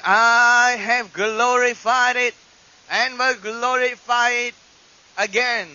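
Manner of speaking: loud, drawn-out divine pronouncement; voice of God. Acted. Preacher voicing what Jesus heard from heaven, solemnly proclaiming. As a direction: proclaim it slowly, as God speaking from above. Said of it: "the actual voice".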